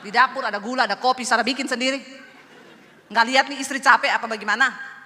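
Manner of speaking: loud acted wifely scolding; hammed-up quarrelling wife; exasperated retort. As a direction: fire back at the husband, loud and insistent. Wife snaps back in acted quarrel.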